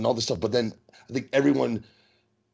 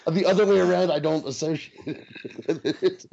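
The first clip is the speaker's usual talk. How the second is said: high-pitched, breaking into laughter; laughing protest; 7 semitones higher than usual. Real, not acted. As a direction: protest while trying not to laugh. Laughing at the racist joke.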